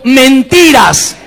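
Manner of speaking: drawn-out shout, slow and loud; shouted response with congregation. Real, not acted. Pastor leads the church in shouting it back. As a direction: call it out together, long and loud. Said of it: "Levantá las dos manos al cielo y grita conmigo".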